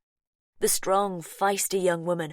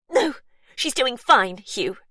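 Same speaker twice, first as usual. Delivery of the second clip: higher, much louder refusal; sharp denial; 7 semitones higher, 9 dB louder than usual. Acted. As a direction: shut him down. Sister refuses help.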